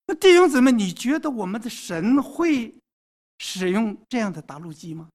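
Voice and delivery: ordinary talk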